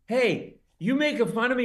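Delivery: hammed-up drunk challenge; drunk guy challenging. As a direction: square up to the comic. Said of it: "he goes".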